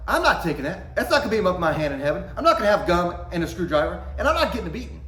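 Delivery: indignant protest. Retold or imitated. Kid refusing to take the blame, loud protest. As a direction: protest loudly, laughing a bit.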